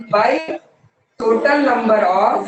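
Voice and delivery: very slow, every word held apart; teacher dictating to a class; slow dictation. Shown as a demonstration. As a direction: spell the formula out for note-taking.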